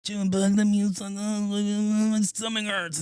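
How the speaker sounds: high, slow put-on voice; imitated neighbour voice. Host imitating what he hears from the street, high and slow. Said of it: "then I hear".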